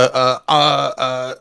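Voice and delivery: normal talking voice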